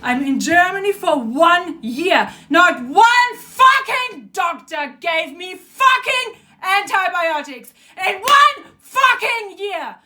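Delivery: heated, frustrated rant; real anger. Real, not acted. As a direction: punch out the swear words in real frustration. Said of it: "I'm so fucking angry".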